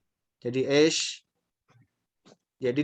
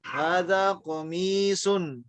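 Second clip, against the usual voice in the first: very slow, each syllable stretched out; teacher model reading; 3 semitones higher, much slower than usual. Shown as a demonstration. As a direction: drag the words out so they can copy. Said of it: "Sekarang saya baca lagi". (guru membaca sangat pelan untuk ditirukan)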